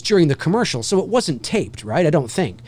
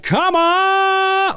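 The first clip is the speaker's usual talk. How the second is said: long, high held come on; stretched announcer call. Retold or imitated. Voice actor milking the announcer line for show. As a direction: hold the call out for the crowd. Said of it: "I extended that".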